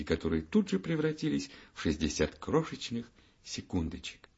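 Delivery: ordinary delivery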